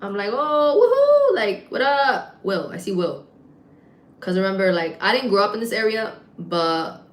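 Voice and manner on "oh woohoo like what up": high, drawn-out woohoo; excited greeting. Retold or imitated. Bartender spots a cousin in the crowd, excited greeting. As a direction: whoop hello across a crowded room. Acts out spotting cousin at bar.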